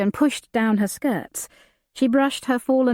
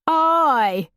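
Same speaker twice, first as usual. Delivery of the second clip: rough outlaw; 7 semitones higher than usual. Acted. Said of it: "another agreed".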